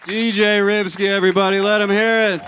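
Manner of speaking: rally the crowd for applause; MC hype call. Real, not acted. Host hyping the DJ to the crowd, shouted announcement.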